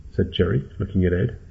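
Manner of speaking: ordinary delivery, nothing marked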